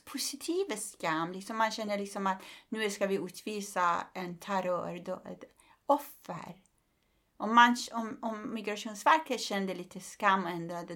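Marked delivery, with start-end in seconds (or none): none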